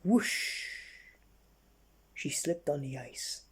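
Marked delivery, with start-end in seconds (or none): 0.0-0.7 sliding whoosh sound. Acted. Narrator voicing the slip on the ice with a whoosh. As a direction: breathe out a slippery whoosh.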